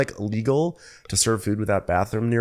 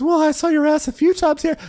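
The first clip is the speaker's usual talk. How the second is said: much higher, sing-song dig; catty comeback; 3 dB louder than usual. Retold or imitated. Brandy teasing Dorinda about twerking, high catty dig. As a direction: needle her sweetly. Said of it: "She says".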